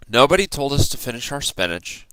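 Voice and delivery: ordinary delivery, nothing marked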